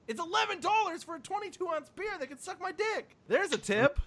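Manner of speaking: high-pitched indignant outburst; worked-up, aggressive complaint; aggressive rant. Real, not acted. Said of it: "Sorry, that was aggressive".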